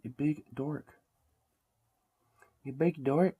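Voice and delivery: high soft teasing coo; pet talk. Real, not acted. Owner teasing her dog, high fond coo. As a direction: coo the word dork up high.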